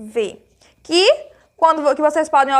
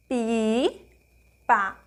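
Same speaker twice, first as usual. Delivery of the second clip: slow syllables; 4 semitones lower, 5 dB quieter than usual. Shown as a demonstration. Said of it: "Quantas vezes eu abri a boca?".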